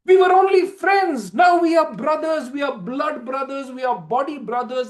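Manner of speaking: high, loud joyous cheer; high excited whoop; thrilled friends. Acted. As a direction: shout it out in delight. Men thrilled.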